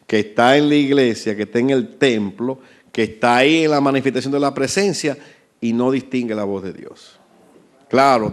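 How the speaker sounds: ordinary talk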